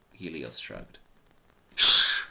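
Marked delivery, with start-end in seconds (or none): 1.8-2.2 sharp loud shushing hiss. Acted. Reuven shushes Helios with a sharp loud hiss. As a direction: shush him hard. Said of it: "Reuven hissed".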